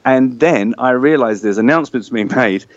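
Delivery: normal talking voice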